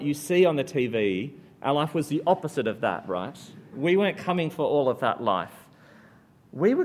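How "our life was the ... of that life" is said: ordinary delivery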